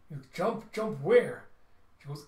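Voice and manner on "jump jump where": louder, higher shocked question. Retold or imitated. Husband stunned by his wife's order to jump, loud shock. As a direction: throw it back in disbelief.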